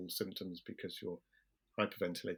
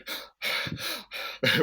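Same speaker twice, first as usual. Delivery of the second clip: high, loud unvoiced frantic breaths; mock hyperventilating; 14 semitones higher, 5 dB louder than usual. Showing the panic growing worse, loud frantic gasping. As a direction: pant hard as if hyperventilating.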